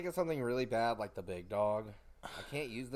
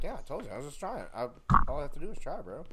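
higher, louder cocky brag; cocky gloating; 5 semitones higher, 9 dB louder, faster than usual. Pretend. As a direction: gloat like a heel after a win. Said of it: "I'm like".

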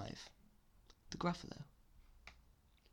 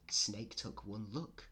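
ordinary delivery, nothing marked

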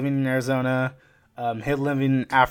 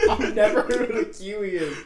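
higher pitch, full of laughter; laughing protest; 7 semitones higher, 3 dB louder than usual. Real, not acted. Host reacting to guest's apology to Kiwis, laughing. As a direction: get it out while cracking up.

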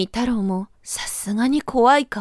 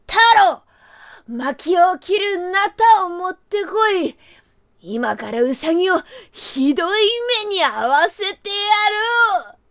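put-on angry old man; 6 semitones higher than usual. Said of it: "怒ったおじいさんは".

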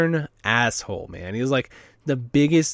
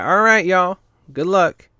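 lightly voiced old clerk, higher and louder; hint of a Southern clerk; 6 semitones higher, 5 dB louder than usual. Clerk leaving them at the truck, lightly voiced Southern. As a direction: toss it off like the lanky old clerk.

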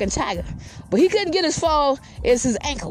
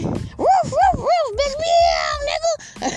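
high squeaky cartoon yaps and meows; yap, then meow, like two tiny pets; barking dog, meowing cat; much slower than usual. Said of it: "says the cat and the motherfucking dog".

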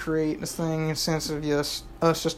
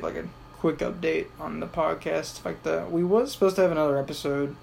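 ordinary talk

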